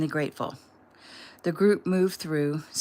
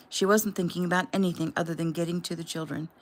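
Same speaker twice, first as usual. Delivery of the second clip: ordinary delivery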